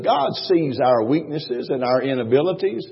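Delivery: ordinary talk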